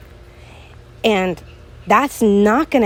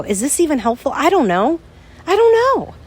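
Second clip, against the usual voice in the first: anxious, climbing much higher on each I don't know; self-doubting; 8 semitones higher, faster than usual. Retold or imitated. Host imitating her own doubt while recording, anxious rising pitch. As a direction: voice the worry that the show helps nobody. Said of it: "sometimes I get on here and I go".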